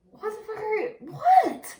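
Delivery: a high incredulous yell; shocked outburst. Retold or imitated. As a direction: shout it in stunned disbelief. Retelling her shocked reaction.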